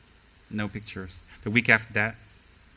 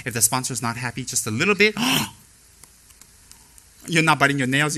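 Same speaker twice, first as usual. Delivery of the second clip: ordinary talk, nothing marked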